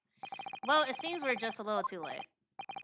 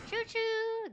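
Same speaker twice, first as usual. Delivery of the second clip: mouse squeak; 13 semitones higher than usual. Pretend. Fake mouse noise that sounds like a train, high squeak. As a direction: squeak again, tiny mouse. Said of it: "That sounds like train".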